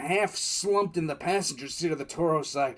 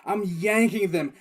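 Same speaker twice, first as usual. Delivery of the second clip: ordinary talk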